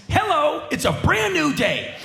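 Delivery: high, loud wide-eyed hello; stoned goldfish wonder. Retold or imitated. Stoned concert-goer waking to the world every half minute. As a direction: greet the day as if it's brand new. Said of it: "Every thirty-five seconds".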